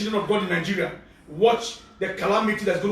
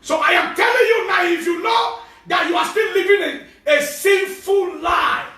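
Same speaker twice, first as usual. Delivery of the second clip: loud higher shout, sinful slowed right down; preaching at full force; 7 semitones higher, 4 dB louder than usual. Acted. Pastor warning sinners again, slow loud preaching shout. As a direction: bellow the warning.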